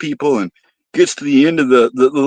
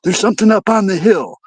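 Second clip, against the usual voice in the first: higher, alarmed whispery-free campers asking; nervous camper asking his friends; startled campers; 6 semitones higher than usual. Retold or imitated. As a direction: sound spooked, voice rising. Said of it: "they were like".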